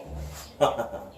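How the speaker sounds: brief, light laugh; a little chuckle to yourself; short chuckle. Real, not acted. Laughs after naming the key.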